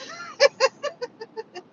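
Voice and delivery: high, loud helpless laughter; real laughing fit. Real, not acted. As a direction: crack up at your own mistake. Laughs at her own mispronunciation.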